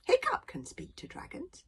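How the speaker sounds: small, high, hushed voice; very quiet. Acted. Fishlegs pipes up from the crowd, very quietly. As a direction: pipe up softly from the crowd. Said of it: "said Fishlegs very quietly".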